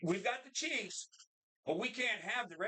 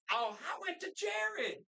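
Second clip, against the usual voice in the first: high-pitched, drawn-out mock squeal of joy; gushing excitement; 10 semitones higher, slower than usual. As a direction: gush it slowly, way up high. Acting out excited ring reaction.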